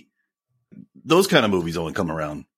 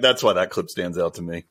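ordinary talk

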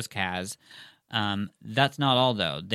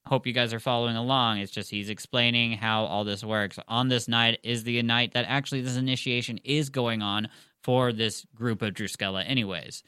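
plain reading voice

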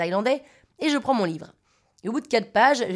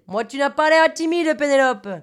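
higher, louder Marseille man; pitched-up southern French, Marseille style; imitated Marseille accent; 5 semitones higher, 6 dB louder than usual. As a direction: put on a loud Marseille accent.

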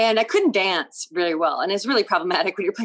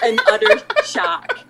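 laughing; 5 dB louder than usual. Real, not acted. Laughing at her own story.

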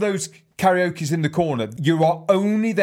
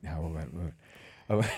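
quiet reply; 14 semitones lower, 11 dB quieter, faster than usual. Retold or imitated. Mate's quiet promise retold.

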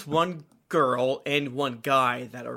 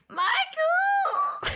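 shouting a name. Retold or imitated. Mocking how she reads the outro.

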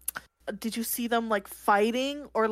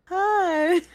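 sweet greeting; 8 semitones higher, 5 dB louder than usual. Acted. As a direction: coax a shy animal. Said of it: "go over really slowly and be like".

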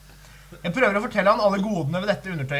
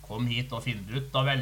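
suggestive Henning; 6 semitones lower, 4 dB quieter than usual. Retold or imitated. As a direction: lower and softer, a mock seductive come-on. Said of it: "Han svarer så fint". (lav, forførende stemme)

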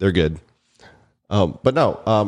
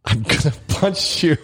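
laughing mock threat; 6 semitones higher than usual. Real, not acted. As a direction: threaten while cracking up.

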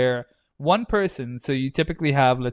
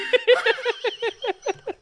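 high breathless wheeze; laughing till it hurts; wheezing laugh. Real, not acted. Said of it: "[name] broke himself".

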